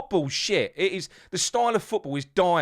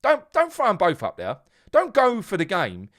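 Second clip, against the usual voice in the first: ordinary delivery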